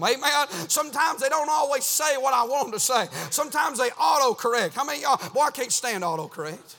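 driving, rhythmic pulpit cadence; fervent preaching. Real, not acted. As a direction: preach it hard in short urgent bursts. Preaches at full force.

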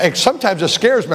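usual speaking voice

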